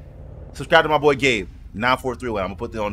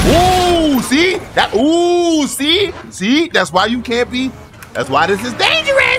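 very high, loud yelps; high-pitched, worked-up exclaiming; alarmed shouting; 14 semitones higher, 7 dB louder, slower than usual. Real, not acted. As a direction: yell a warning after a near miss. Near crash at speed.

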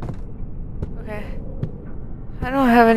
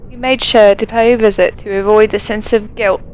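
reading aloud; 4 semitones lower than usual. Acted. Reads in-game note aloud.

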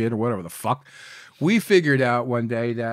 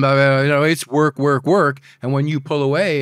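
ordinary talk, nothing marked

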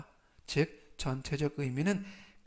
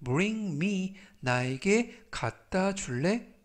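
ordinary talk

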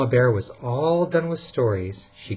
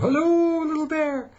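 very high singsong hello; high calling; 4 dB louder than usual. Acted. Papa Bear keeps calling for the cub, high and singsong. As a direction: call up high, sing it out. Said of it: "said Papa Bear".

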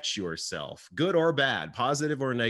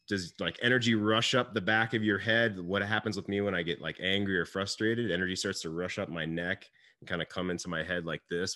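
ordinary delivery

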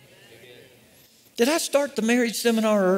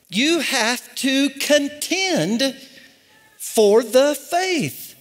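very slow, weighty word-by-word delivery; emphatic slow exhortation; 4 semitones higher, much slower than usual. Real, not acted. Preacher urging the church to contend, slow emphatic exhortation. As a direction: drive each word home slowly. Preacher drives home the verse.

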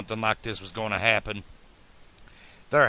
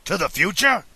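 high, loud startled question; 8 semitones higher, 5 dB louder than usual. A narrator's reading. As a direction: react with sudden disbelief. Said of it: "quite startled by the idea".

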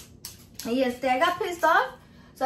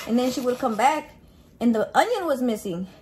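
usual speaking voice

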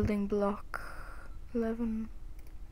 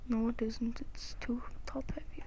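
whispering; 2 semitones higher, 9 dB quieter than usual. Real, not acted. Muttering to self.

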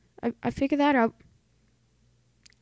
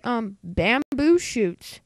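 ordinary talk

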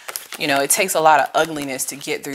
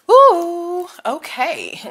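surprised; 14 semitones higher, 6 dB louder, much slower than usual. Real, not acted. Reacting to a card.

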